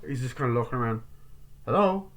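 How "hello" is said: high, louder questioning call; lifted, searching hello. Acted. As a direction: call out to whoever might be hiding. Acting character calling into empty place.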